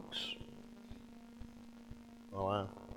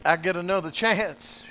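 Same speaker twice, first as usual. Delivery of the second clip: higher, louder joyful cry; excited; 9 semitones higher, 11 dB louder than usual. Acted. Preacher proclaiming a second chance, excited cry. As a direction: announce the second chance with joy.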